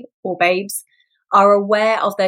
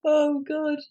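laughing at herself; 7 semitones higher, 6 dB quieter than usual. Real, not acted.

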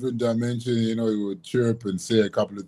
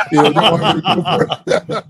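laughing; 8 semitones higher, 5 dB louder than usual. Retold or imitated. Laughing while retelling the plea.

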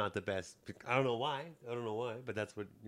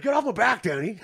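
much higher, louder laughing mock snap; mock irritated; 11 semitones higher, 10 dB louder than usual. Lance brushing off Danny's nagging, laughing mock annoyance. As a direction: throw it off like he's annoyed but it's a joke.

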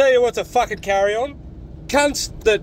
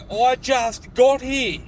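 normal talking voice

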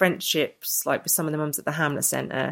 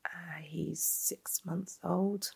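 whispered retold reply; 2 semitones higher, 13 dB quieter, much slower than usual.

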